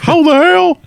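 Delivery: high cartoonish squeal; put-on high squeaky voice. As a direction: squeak it out, mock baffled. Mock high cartoon outburst.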